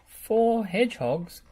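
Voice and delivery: high, slow astonished question; surprised gulp. Acted. Wendy astonished on the phone with Bob. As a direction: gasp it in disbelief. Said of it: "gulped Wendy".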